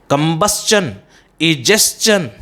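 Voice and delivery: कंबस्चन and इजेशन both ending "s-chun"; correct pronunciation. Shown as a demonstration. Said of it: "the correct pronunciation".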